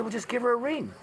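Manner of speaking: a hint of the amused mentor giving advice; mentor's easy advice.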